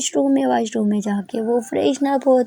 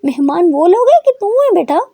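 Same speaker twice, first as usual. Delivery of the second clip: angry mother; 6 semitones higher, 5 dB louder than usual. A narrator's reading. Mother scolds late daughter.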